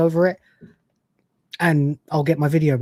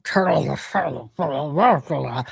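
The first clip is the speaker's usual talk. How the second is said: slowed, slurred mimicry of the old admiral; mock mumble; 4 semitones lower, 4 dB quieter, much slower than usual. Streamer mocking the old admiral's mumbled line, slurred and slow. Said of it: "let's not total the ship".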